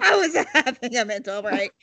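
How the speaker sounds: laughing through the words. Real, not acted. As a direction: giggle it out. Laughing second take.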